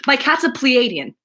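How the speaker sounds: sudden loud, high outburst; imitated blurting. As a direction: blurt it out like word vomit. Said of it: "looks at me and word vomits".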